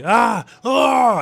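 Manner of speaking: two loud, high screams; pained screams. Retold or imitated. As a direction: cry out as if grabbed hard. Luffy grabbed by the jaw.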